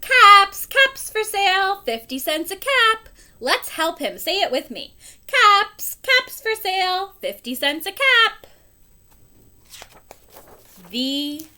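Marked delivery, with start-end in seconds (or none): 0.0-3.1 peddler's street cry, high and loud; loud, high vendor's call with a big first word. A narrator's reading. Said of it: "he walked back to town calling". 5.2-8.5 peddler's street cry, high and loud; loud, high vendor's call with a big first word. Acted. Leads listeners in peddler's call.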